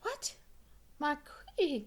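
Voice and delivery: a delighted king caught off guard; king's surprised cry. Acted. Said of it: "cried the king".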